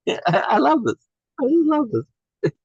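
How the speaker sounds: rising very high, slow and gleeful; delighted. Real, not acted. Teacher delighting in the lawn image, high and gleeful. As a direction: swoon with delight at the idea.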